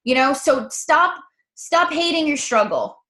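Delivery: ordinary delivery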